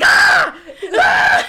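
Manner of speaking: very high, loud scream, breaking into a laugh; retold fright scream, then laughing; startled scream. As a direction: shriek as if your husband snuck up on you. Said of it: "and I'm like".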